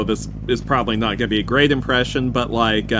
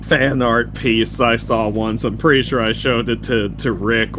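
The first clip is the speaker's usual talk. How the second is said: usual speaking voice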